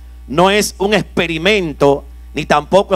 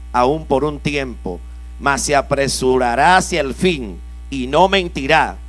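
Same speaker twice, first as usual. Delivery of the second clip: scripture reading; 4 semitones lower, 3 dB quieter than usual. Acted. Preacher reads scripture aloud.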